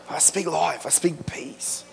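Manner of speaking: whispered, slow declaration of faith; hushed prayer. Shown as a demonstration. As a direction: breathe it out as a quiet prayer. Models a prayer over trouble.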